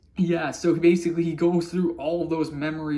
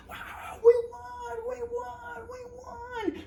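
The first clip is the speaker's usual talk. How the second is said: much higher, tearful squeal of joy; mock victory cheer; 6 dB quieter, slower than usual. The crowd after Darcy's defeat, high, tearful cheering. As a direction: squeal the win like happy crying. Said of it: "people are like".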